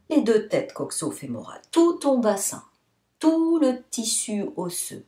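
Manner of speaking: normal talking voice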